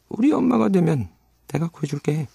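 high-pitched, wheedling character voice; coaxing pirate. Acted. Pirate coaxes Wendy to be his mother, wheedling.

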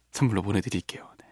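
hushed whisper. Real, not acted. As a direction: whisper it softly. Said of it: "unvoiced whisper".

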